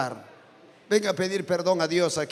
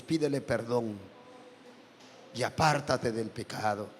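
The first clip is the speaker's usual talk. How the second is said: soft altar call; 5 semitones lower, 4 dB quieter than usual. Acted. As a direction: draw them gently to the altar. Altar call after sermon. (llamado al altar, suave y tranquilo)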